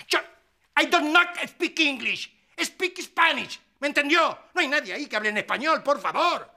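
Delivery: exasperated rant. Acted. Caller berating an operator who speaks no Spanish, exasperated. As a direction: demand furiously to be understood. Arguing on phone, not understood.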